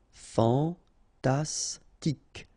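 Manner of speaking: very slow "Fantastique", fan-tas-tique; syllable by syllable. Shown as a demonstration. French teacher modelling for learners, "Fantastique" slowly syllable by syllable. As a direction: pause between each syllable of "Fantastique".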